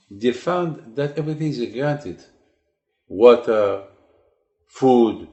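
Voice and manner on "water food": emphatic listing. Acted. Listing granted gifts emphatically.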